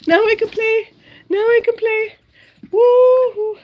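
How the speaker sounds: very high, loud, gleeful pet voice; voicing the dog. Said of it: "She's like".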